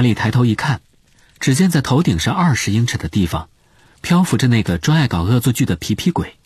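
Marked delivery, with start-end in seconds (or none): none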